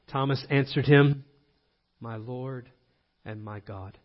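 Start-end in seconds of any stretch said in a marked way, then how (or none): 2.0-4.0 hushed, low awe; very soft, solemn avowal; reverent confession. Acted. Voicing Thomas's awed confession.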